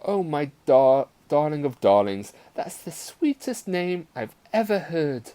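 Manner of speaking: gushing mother, pitch rising high. A narrator's reading. Mother praising her daughter's choice of name, gushing. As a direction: gush over it like a doting mother. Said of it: "gushed Mamma".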